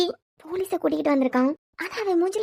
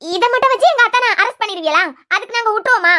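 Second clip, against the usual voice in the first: higher, louder, daring him to try; louder defiant woman protecting her family; defiant protest; 3 semitones higher, 4 dB louder than usual. Acted. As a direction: challenge him, loud and proud. Woman refusing to allow arrest.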